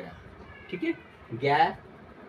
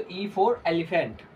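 slow dictation; 2 semitones higher, much slower than usual. Shown as a demonstration. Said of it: "teacher dictating letters slowly".

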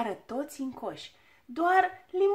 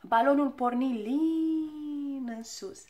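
ordinary delivery

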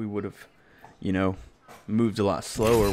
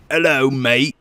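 louder put-on Australian greeting; Australian accent; 3 semitones higher, 5 dB louder, slower than usual. Shown as a demonstration. Gamer demonstrating impressions to teammates, Australian accent. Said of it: "And then I have an Australian".

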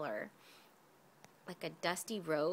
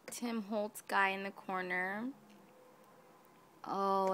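ordinary talk

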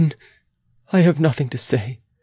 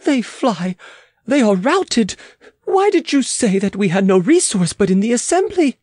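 higher-pitched, triumphant outburst; excited cry; 11 semitones higher than usual. Acted. Queen seeing the attackers routed, crying out excitedly. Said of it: "she cried with flaring eyes".

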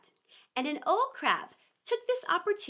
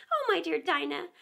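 tearful; 7 semitones higher than usual. Acted. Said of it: "began to cry again".

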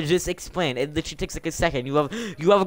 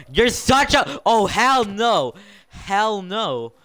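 high, loud hell no, slowed; outraged yell; 6 semitones higher, 3 dB louder, much slower than usual. Real, not acted. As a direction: yell it in disbelief. Reacting to teammate's trick.

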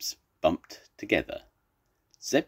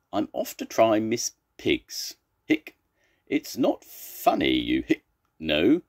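raised complaint broken by hiccups; hiccuping; 5 semitones higher than usual. Acted. Zebra scolds amused Tiger, hiccuping. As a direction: object between hiccups. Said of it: "Zebra's got hiccups".

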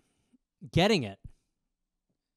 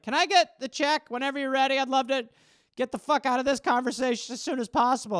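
lightly higher, mock polite child; uncomfortable kid; 5 semitones higher than usual. Boy asking to leave dad's talk, a bit higher. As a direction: ask for the check like a trapped boy.

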